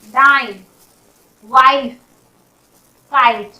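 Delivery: ordinary delivery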